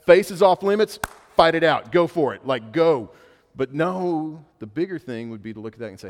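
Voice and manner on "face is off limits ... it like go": loud, higher mock yell at the boys; a fed-up father giving up on keeping peace; a father yelling at his fighting boys in mock exasperation; exasperated dad. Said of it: "I look at my boys and I'm like".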